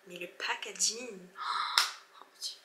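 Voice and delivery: real breathy gasp of awe, trailing into a whisper; softly marvel, voice dropping away to breath; hushed awe. Real, not acted. S'extasie devant le packaging. (émerveillement soufflé, presque chuchoté)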